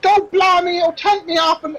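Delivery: high-pitched, put-upon barmaid; harassed serving girl, voice pitched right up; exasperated barmaid. Acted. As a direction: complain back at a nagging boss. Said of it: "She's like".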